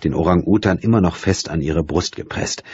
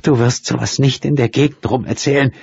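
strained, shaky; 8 semitones higher than usual. Acted. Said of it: "gepresst und mit zittriger Stimme".

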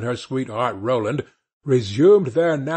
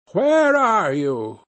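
slow, higher call of the witch; 6 semitones higher, 3 dB louder, slower than usual. A narrator's reading. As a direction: call out slowly, searching. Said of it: "Then the witch cried".

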